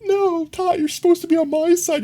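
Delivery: high whiny complaint; high-pitched mock hurt; mock whine. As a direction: whine at him playfully. Mock-scolding Todd.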